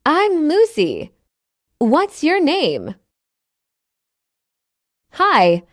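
ordinary talk

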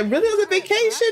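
high, loud, quick disbelief; astonished question. Acted. Friend hears news from the vacation, high-pitched astonishment. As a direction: squeal the question in surprise. Friend reacts to engagement story.

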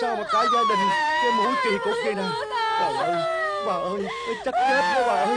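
frantic high panic; panicked shriek. Acted. Family panics over noses.

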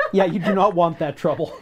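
really laughing while agreeing; laughing warning. Real, not acted. Agreeing with friends about the goof fight, laughing.

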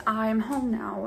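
ordinary talk

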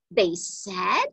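plain reading voice

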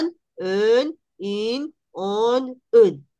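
"on", "un", "in" nasales bien marcadas; vocales nasales. Shown as a demonstration. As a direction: pronuncia cada nasal francesa aparte. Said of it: "Y también pronunciar". (vocales nasales del francés)

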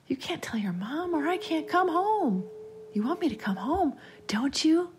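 put-on high, insistent child; eerie playmate pressuring; persuading child voice. A narrator's reading. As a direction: push to convince him, up high. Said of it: "making one final push to convince him".

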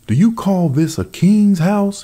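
high, loud mocking bear voice; shrill-pitched scornful outcry; bear crying out, high. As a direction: cry out in scorn at the wren's nest. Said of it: "he cried to the wolf".

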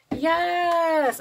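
high, loud yes; thrilled yes; excited yes. Real, not acted. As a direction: cheer for the lashes. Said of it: "I'm so excited to try these".

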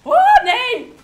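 very high, very loud cry of dismay; anguished scream. Real, not acted. Gamer getting killed, anguished scream. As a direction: scream it in real despair. Dies in game.

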